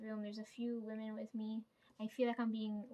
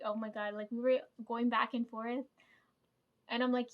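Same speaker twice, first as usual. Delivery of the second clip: ordinary talk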